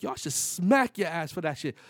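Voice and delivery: high, loud acted outrage; indignant threat. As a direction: threaten the hustler in mock fury. Acted quarrel with the kiosk hustler.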